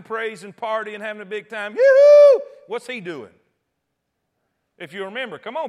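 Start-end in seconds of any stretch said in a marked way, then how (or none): 1.7-2.4 loud high party whoop; sudden high crowd cheer; crowd shouting. Acted. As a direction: let out a piercing celebratory hoot. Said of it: "They're shouting".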